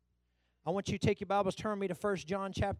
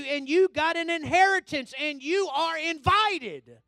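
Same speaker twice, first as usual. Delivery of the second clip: rousing preaching; 7 semitones higher, 9 dB louder than usual. Real, not acted. Preacher telling believers they are invited to God's table, mounting fervour. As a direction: build from steady exhortation to raised proclamation.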